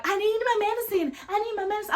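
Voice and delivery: high, insistent whiny plea for her medicine; whiny lady. Retold or imitated. Daughter mocks her mother at the pharmacy, high whiny plea. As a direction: mimic her pleading high and petulant. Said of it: "this lady is just like".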